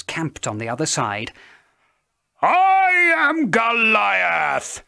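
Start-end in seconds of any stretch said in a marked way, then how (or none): 2.4-4.8 slow booming high shout, much louder; giant's bellow. A narrator's reading. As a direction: roar it out like a giant. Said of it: "he bellowed".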